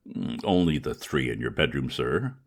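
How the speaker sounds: low, unruffled butler voice, matter of fact; calm valet reply. Acted. Valet answering his master about the cats, low and unruffled. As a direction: report it as if nothing were odd.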